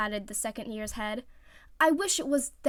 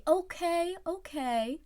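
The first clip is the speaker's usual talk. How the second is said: placating; 8 semitones higher, much slower than usual. Acted. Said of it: "hands up in surrender".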